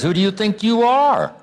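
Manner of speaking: mock them with the question; biting rhetorical taunt; sarcastic. Shown as a demonstration. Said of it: "Sarcasm says".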